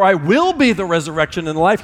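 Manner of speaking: "will" lifted and stressed as the wrong tense. Shown as a demonstration. Pastor shows the church the wrong tense, stressing "will". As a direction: hit "will" to show the future he didn't claim.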